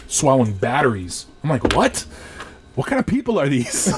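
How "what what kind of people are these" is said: high shocked question; stunned, half laughing; surprised disbelief. Retold or imitated. Shocked at the doctors' stories.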